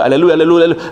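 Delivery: mock rapid-fire repetition, words running together; rapid aleluya. Fake coach showing how to force tongues, mock rapid chanting. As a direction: rattle it off like a tongues drill. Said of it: "diga aleluya rápido".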